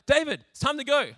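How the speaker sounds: urgent call. Acted. Messenger calling David to the angry king, loud and urgent. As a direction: hurry him up with a loud call. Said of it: "the guy would be like".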